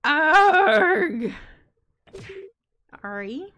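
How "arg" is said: loud, pained wordless wail; anguished cry. Acted. Teenage daughter howling at doom. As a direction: let out a howl of despair. Character cries out in despair.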